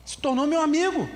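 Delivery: excited friend. Retold or imitated. Old friend tells people they became friends, excited. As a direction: call it out gladly, lifted up. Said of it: "e fala assim".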